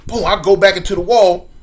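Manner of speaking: normal talking voice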